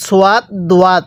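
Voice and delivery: সোয়াত দোয়াত with a wa glide in each; wrong-example সোয়াত দোয়াত in two syllables each; mistaken reading. Shown as a demonstration. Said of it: "এরকমভাবে পড়লে পড়াটা সহি শুদ্ধ হবে না".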